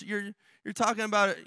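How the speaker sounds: normal talking voice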